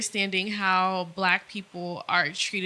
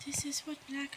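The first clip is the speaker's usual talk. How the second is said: whisper; 5 semitones higher, 13 dB quieter than usual. Retold or imitated. Retold inner thought, whispered.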